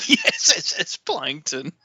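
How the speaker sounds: laughing, S S S stretched grandly; drawn-out s like a snake. Acted. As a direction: drag out each S. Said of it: "We're exaggerating for effect".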